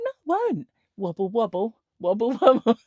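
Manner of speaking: mock him again, gleefully; playful schoolyard taunt.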